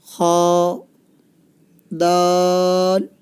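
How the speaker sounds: each Arabic letter name drawn out slowly and clearly, with a pause after each; slow letter recitation. Shown as a demonstration. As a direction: recite the alphabet slowly for a child to copy. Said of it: "सही पढ़ना है". (हर हर्फ़ धीरे और साफ़ बोलें)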